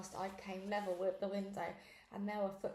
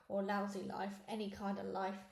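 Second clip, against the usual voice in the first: usual speaking voice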